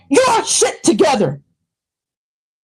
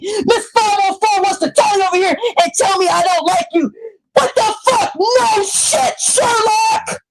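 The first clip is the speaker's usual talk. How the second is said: furious shouting; 4 semitones higher than usual. Real, not acted. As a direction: tear into her, yelling at full force. Furious rant at a commenter.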